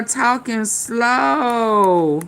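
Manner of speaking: slow, stretched-out words; drawn-out complaint. Real, not acted. Streamer fed up with people talking too slow, slow drawn-out complaint. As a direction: drag each word, especially the last. Complaining that others talk slowly.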